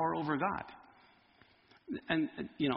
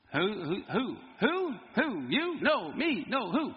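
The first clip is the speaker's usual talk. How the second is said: Who's on First bit; slower than usual. Acted.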